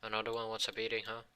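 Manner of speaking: usual speaking voice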